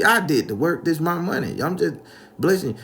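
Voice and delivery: high, loud self-defence; acted pleading objection; indignant protest. Acted argument, defending himself.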